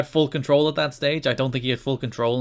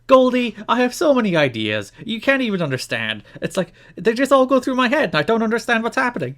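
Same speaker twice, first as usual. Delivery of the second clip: high, louder mock Raven, lost and rambling; Raven impression; 10 semitones higher, 4 dB louder than usual. Mocking Raven's promo to co-host, high and hammed-up. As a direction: play the wrestler Raven forgetting his lines, stalling. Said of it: "He's like".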